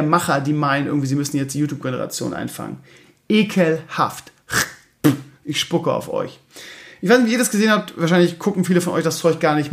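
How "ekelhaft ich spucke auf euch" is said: disgusted. Real, not acted. Disgust at TV show makers.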